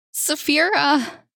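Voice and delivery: high, loud, slow wail of her name; anguished scream. Acted. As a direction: cry out in horror. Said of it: "And then he screamed".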